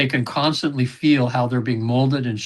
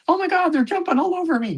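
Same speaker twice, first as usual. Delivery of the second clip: much higher, thrilled squeal of delight; overjoyed pet owner. Retold or imitated. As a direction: squeal it like a pet owner seeing her dogs again. Client reunited with her dead pets.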